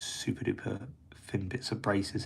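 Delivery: whispered through a held breath; holding breath. Real, not acted. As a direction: hold your breath while talking, barely voiced. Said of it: "I'm just holding my breath here".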